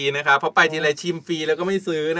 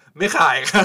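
vendor refusing. Acted. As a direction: put on a stretched-out vendor's voice, amused. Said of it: "ถ้าเขาเป็นแม่ค้าเขาจะบอกว่า".

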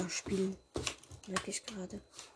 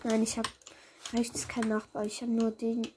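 normal talking voice

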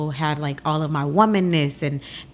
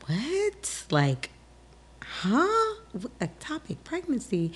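high, stunned "what?" with rising pitch; shocked disbelief; 9 semitones higher, 4 dB quieter, slower than usual. Retold or imitated. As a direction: react as if you can't believe the diagnosis. Hearing shocking news from doctor.

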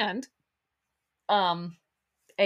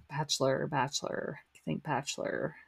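self-calming mutter; 5 semitones lower, 8 dB quieter than usual. Real, not acted. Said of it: "try to get your mind off of it".

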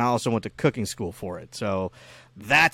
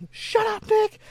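much higher mom voice, sharp; mom snapping; slower than usual. Retold or imitated. Retelling a childhood story, mom snaps at nagging Nick in a high voice. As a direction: play the fed-up mom shutting the kid down.

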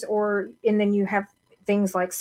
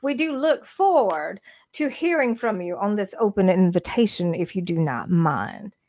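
genteel Southern character. Acted. Inviting Auntie back on screen, genteel Southern accent. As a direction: put on a gracious Southern lady accent. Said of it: "slip into that character if I need".

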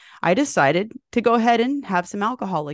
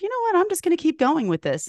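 much higher, quick burst of sudden decision; a spark of inspiration mid bike ride; bright mock-eager inner voice, pitched up high; excited resolve; 10 semitones higher, faster than usual. Retelling her own decision on ride.

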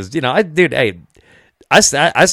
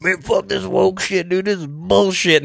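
a hammed-up hillbilly complaint, higher and slower; mocking hillbilly; 7 semitones higher, slower than usual. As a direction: parody an angry hillbilly, lightly. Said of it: "hillbillies who were like".

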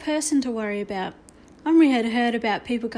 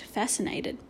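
plain reading voice

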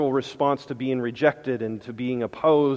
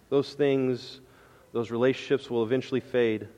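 normal talking voice

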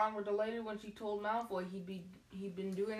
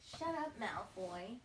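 Parvati voice; slower than usual. Shown as a demonstration. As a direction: snap back at the bully as the schoolgirl. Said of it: "Wait, no, that's a Trelawney".

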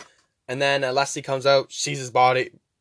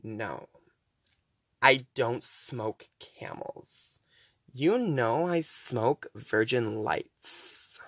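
soft, slow, whiny drawl; annoying Leslie; 2 semitones lower, 6 dB quieter, much slower than usual. Retold or imitated. Leslie refusing the wrong cigarettes, slow whiny drawl. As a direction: drag every word out, bored and demanding. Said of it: "the way Leslie talks".

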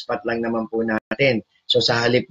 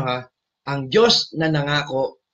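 ordinary talk, nothing marked

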